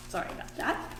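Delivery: soft unvoiced apology; whispered aside. Real, not acted. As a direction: whisper it off to the side. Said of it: "aside after stumbling".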